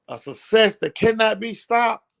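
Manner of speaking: ordinary delivery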